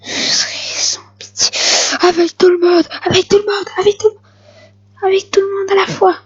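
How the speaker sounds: hammed-up sinister threat rising from a whisper to a high cry; mock villain threat. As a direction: ham up the merciless villain, whisper then wail. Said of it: "dramatic retake of the boast".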